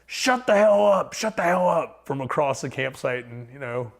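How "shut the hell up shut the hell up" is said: imitating an angry camper's yell, pitched up; half-hearted retold yell; mock yell from a stranger. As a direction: yell it like the grumpy neighbor across the camp. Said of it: "this guy yells out to us".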